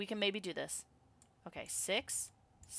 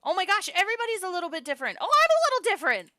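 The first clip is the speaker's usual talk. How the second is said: high, loud, fast exclaiming; excited discovery; 14 dB louder, faster than usual. Real, not acted. Player spots changed pictures, loud excited rush. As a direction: gush loudly in a rush.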